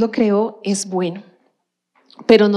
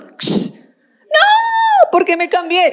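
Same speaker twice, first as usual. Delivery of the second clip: regret; much slower than usual. Retold or imitated. Driver regrets changing lanes.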